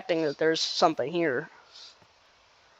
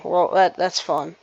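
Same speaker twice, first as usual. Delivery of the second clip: ordinary delivery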